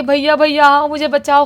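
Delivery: loud, high cry of bhaiya for help; calling for help. Retold or imitated. Girl yelling down to her brother from the roof, loud and high. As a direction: shout up to him to come save her. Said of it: "ये ऐसा चिल्लाने लगती है".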